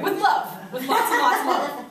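high, loud, laughing sweetener; playful reassurance. Real, not acted. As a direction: gush it jokingly. Softening a threat to ignore.

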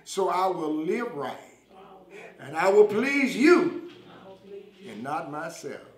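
slow short phrases with long pauses for repetition; leading a prayer. Real, not acted. Preacher has viewers repeat a prayer for salvation, slow and fervent.

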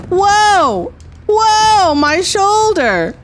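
high, drawn-out alarmed yelp; startled cry. Pretend. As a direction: cry out as the shoulder goes. Startled cry over shoulder in game.

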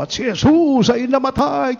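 booming high announcement to the whole congregation; shouted proclamation. Retold or imitated. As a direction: cry it out to the back of the church. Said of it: "sumigaw naman ako".

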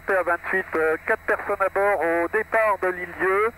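ordinary talk, nothing marked

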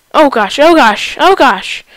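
panicking gamer. Real, not acted. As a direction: panic as the enemies close in. Gamer panicking in a big fight.

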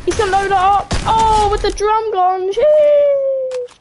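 high, excited gamer squeal. Real, not acted. Streamer reacting to a trick shot chance, excited. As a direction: rise to a loud, drawn-out high squeal.

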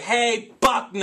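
loud, high, drawn-out taunting call; heckler's yell. Retold or imitated. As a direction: re-enact yelling at someone across the street. Heckle yelled at the ballplayer.

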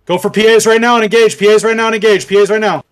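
high, loud battle command; urgent shout. Real, not acted. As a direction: bark the attack order. Commander calls engage.

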